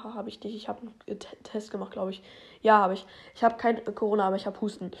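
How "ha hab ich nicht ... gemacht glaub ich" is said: hushed, unvoiced aside; whispered aside. Real, not acted. Aside muttered about corona test.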